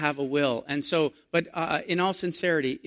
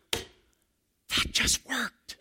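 slow, drawn-out whisper; awed whisper; 6 semitones higher, 4 dB quieter, much slower than usual. Retold or imitated. Pastor and wife stunned at the parking spot, awed whisper. Stunned reaction to answered prayer.

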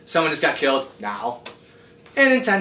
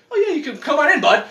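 easygoing God; 10 semitones higher, faster than usual. Retold or imitated. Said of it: "he's like".

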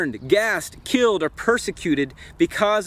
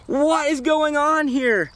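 high, drawn-out amazed question; astounded king; 9 semitones higher, slower than usual. Acted. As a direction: cry out in disbelief, pitch way up, words stretched. Acts the king jumping up amazed.

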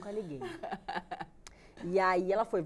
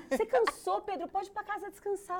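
high-pitched, mock-solicitous mother voice; imitated fussing mother; 13 semitones higher, 3 dB louder than usual. Mother tells son-in-law to go home and rest, high imitated voice. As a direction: put on a high mother's voice, sending him off.

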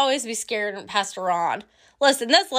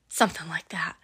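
softer, lower, whispered secret; coy whisper; 6 semitones lower, 7 dB quieter, faster than usual. Real, not acted. Answering her little girl about being happy, in a whisper. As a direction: breathe it like a shy secret.

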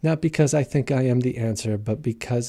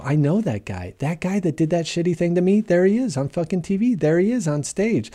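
angry victim recognizing him; 7 semitones higher than usual. Retold or imitated. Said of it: "and go".

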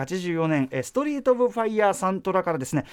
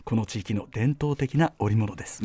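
documentary narration; 4 semitones lower than usual. Shown as a demonstration. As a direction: read it like the narrator of a train travel show. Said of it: "世界の車窓からみたいな".

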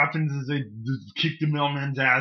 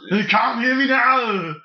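Arnold movie line; 8 semitones higher, 4 dB louder, slower than usual. Acted.

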